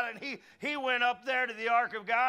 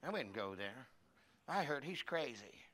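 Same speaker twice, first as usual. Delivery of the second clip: sour relative; 8 semitones lower, 10 dB quieter than usual. Retold or imitated. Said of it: "And they go".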